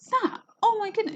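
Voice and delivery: teacher showing the rising tone of a question; astonished questioning tone. Shown as a demonstration. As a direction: squeak it out in disbelief. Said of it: "the tone is there of that questioning intonation".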